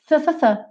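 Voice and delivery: "th" with the tongue at the front teeth; th sound. Shown as a demonstration. Teacher drills the th sound for students. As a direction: push air over the tongue on "th". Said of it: "linguinha lá nos dentes da frente".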